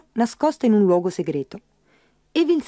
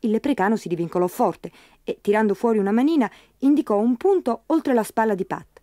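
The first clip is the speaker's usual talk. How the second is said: ordinary delivery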